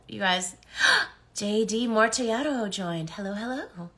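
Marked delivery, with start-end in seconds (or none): 0.6-1.1 sharp high gasp; excited gasp. Real, not acted. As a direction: gasp in delight. Excited gasp at new viewer.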